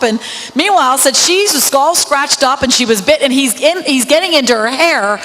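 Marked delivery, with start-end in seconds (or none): none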